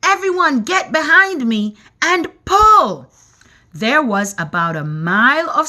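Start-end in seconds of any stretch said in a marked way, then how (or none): 0.0-3.1 high, loud rallying command; rally everyone to haul; shouting. A narrator's reading. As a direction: grasshopper calling the team to pull. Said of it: "shouted the old green grasshopper".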